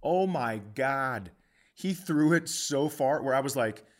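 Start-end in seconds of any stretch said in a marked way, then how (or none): none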